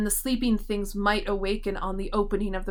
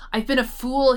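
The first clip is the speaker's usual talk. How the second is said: higher, louder self-reproach; raving; 4 semitones higher, 5 dB louder than usual. A narrator's reading. Tusman cursing his own folly, raised raving voice. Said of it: "he raved".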